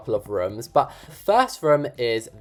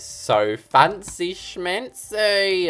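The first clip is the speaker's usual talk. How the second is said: mock posh; 7 semitones higher, much slower than usual. Admiring the royal room.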